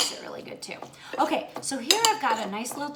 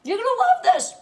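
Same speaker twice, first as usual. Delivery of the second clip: excited; 13 semitones higher, 12 dB louder than usual. Real, not acted. Excited after first bite.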